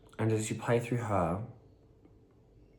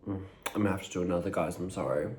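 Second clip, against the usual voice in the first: talking while chewing; speaking through a mouthful of food; mouth full; 15 dB quieter, much faster than usual. Real, not acted. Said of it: "I need to take another bite".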